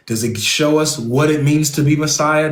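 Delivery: ordinary delivery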